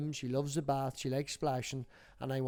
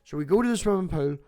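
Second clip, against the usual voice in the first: ordinary talk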